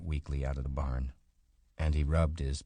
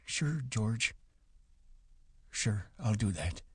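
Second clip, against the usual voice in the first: put-on higher, softer old man, giving in; soft and higher, just going along; meek old Candy; 7 semitones higher, 4 dB quieter, slower than usual. A narrator's reading. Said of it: "Candy agrees meekly".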